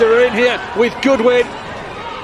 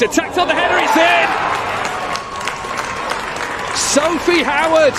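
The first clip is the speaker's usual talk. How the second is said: rising into a goal shout; excited goal call; 6 semitones higher than usual. Real, not acted.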